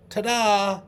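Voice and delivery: high, loud showman's ta-da; magician's ta-da. Pretend. Hand pointing at the learner, magician's triumphant ta-da. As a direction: pop it out like the big reveal of a trick. Magician figure in memory picture.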